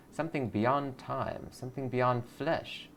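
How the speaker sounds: slow, reverent, hushed promise; drawn-out mystical pitch; slow mystic intoning. Acted. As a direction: lure her in slowly. Mystical creed line.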